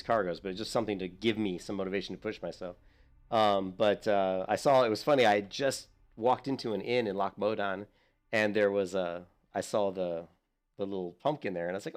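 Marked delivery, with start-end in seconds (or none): none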